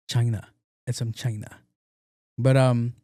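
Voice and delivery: ordinary talk, nothing marked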